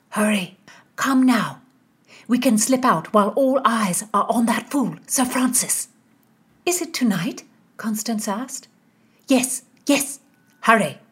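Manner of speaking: urgent hushed hurry; urgent young woman. Acted. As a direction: hurry her along. Nazareth urging escape.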